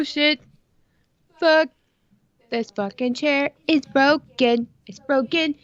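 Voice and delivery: ordinary talk, nothing marked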